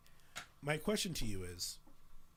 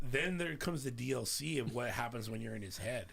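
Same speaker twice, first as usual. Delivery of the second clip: ordinary talk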